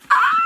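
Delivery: high, loud fangirl scream; excited scream. Real, not acted. Reader screaming over the love interest's name reveal, excited scream. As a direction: let out a loud, high squeal.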